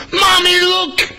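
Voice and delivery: high-pitched imitation of an astonished child; squeaky mock-amazed kid voice; little girl. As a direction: mimic a small girl calling out to her mum. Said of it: "this one little girl".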